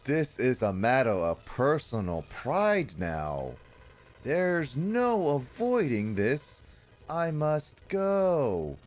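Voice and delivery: very slow, drawn-out grand declaration; hammy game hero. Acted. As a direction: stretch the words high and melodramatic. Reading a cutscene hero's declaration.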